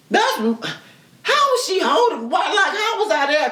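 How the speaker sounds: slow, high disbelieving question; baffled. Real, not acted. Wondering how she was held down, high and slow. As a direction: puzzle over it aloud.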